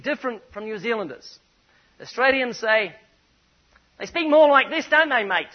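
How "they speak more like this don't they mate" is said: playful Australian impression, louder and higher; mock Australian accent. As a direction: mimic an Aussie mate, hammed-up. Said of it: "It's more nasal".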